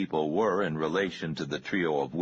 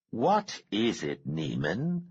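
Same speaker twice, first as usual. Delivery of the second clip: put-on smooth Cambridge accent; 5 semitones higher, slower than usual. A narrator's reading. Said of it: "smooth voice with a trace of Cambridge accent".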